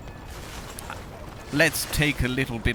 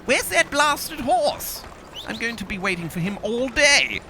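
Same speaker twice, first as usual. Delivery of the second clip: mock-indignant, high-pitched grumbling; impatient calling out; 10 semitones higher than usual. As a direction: holler theatrically for a horse that won't come. Calling impatiently for his horse.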